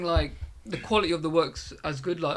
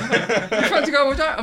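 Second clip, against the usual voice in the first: higher, louder, laughing mock invite; laughing invitation; 8 semitones higher, 6 dB louder than usual. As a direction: invite him while cracking up. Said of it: "You're like".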